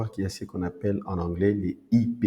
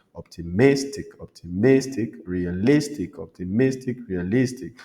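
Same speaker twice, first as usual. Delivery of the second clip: optimistic, realistic with MIS and LIS punched; stress on second-last syllable; 3 dB louder than usual. Shown as a demonstration. Teacher giving examples of -ic words, punched MIS and LIS in optimistic, realistic. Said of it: "l'avant-dernière syllabe".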